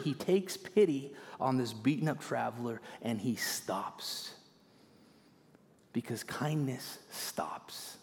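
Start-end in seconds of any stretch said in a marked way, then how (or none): 5.9-8.0 slow, softer whisper with "kindness" drawn out; whispered point. Shown as a demonstration. Preacher whispers the lesson to the congregation. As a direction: lean in and breathe it to them. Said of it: "quiet whispered punchline".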